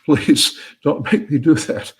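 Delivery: lightly acted, desperate begging; pleading. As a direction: beg half in earnest, rising on the plea. [name] begs the voice in joke.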